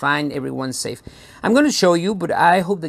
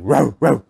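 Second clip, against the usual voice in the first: imitated dog bark; 4 dB louder than usual. Shown as a demonstration. Presenter showing children how dogs sound, imitated bark. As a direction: demonstrate a simple dog bark.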